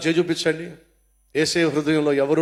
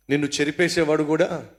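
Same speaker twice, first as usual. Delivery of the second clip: ordinary talk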